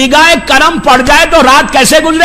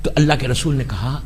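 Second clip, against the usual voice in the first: ordinary talk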